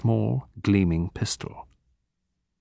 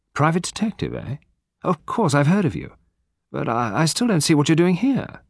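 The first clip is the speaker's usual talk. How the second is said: higher voice, mildly baffled; puzzled; 7 semitones higher than usual. A narrator's reading. Hugo reading Poirot's card, mildly baffled. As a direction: sound puzzled, thinking it over haltingly.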